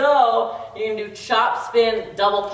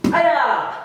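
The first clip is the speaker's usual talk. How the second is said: high, sharp martial arts yell; kiai shout; 4 semitones higher, 3 dB louder than usual. Shown as a demonstration. Instructor leading the class with a high kiai shout. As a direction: snap out the hi-ya on the strike.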